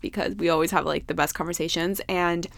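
ordinary talk

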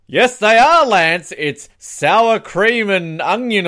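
high, loud, slow mock commercial voice; overdone TV advert pitch; hammed-up ad read. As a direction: sell the flavor like a cheesy ad. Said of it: "can you say this following line".

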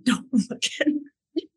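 tearful plea. Retold or imitated. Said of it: "I'm like".

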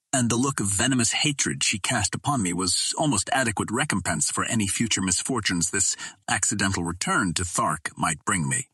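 usual speaking voice

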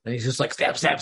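ordinary talk

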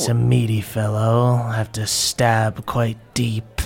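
Zelda. Acted. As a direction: keep it low and unhurried. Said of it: "She looks at him".

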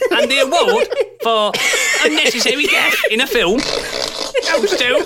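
high, slow laughing announcement. Real, not acted. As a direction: announce it while cracking up. Award announcement while laughing.

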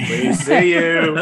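slow, drawn-out ominous warning; slow mock menace. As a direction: loom over them with each word.

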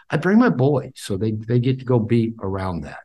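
ordinary talk, nothing marked

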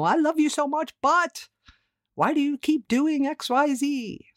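high, loud exasperated plea; exasperated spouse. Retold or imitated. Wife to husband when they get pissy, high and exasperated. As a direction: needle your husband mid-argument. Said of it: "we'll say things like that".

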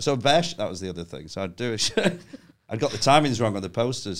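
normal talking voice

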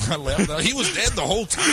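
high laughing exclamation. Real, not acted. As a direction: laugh through the spoiler. Retelling movie spoiler, laughing.

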